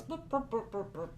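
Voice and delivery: high playful burps for little steps; bouncy burp sounds. Acted. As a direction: mimic tiny creatures hopping down. Imitated Darrow footsteps.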